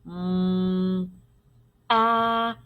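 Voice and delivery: "Mm, ah" stretched as isolated sounds; long hummed "Mm", pause, held "ah"; sounded out slowly. Shown as a demonstration. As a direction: draw out each sound of "math". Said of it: "I hear three sounds".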